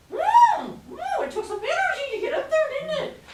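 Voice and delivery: very high, fast talking. Shown as a demonstration. Teacher talking high to show the effort. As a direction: speak way up in the head. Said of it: "Okay, so".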